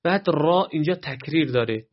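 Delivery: normal talking voice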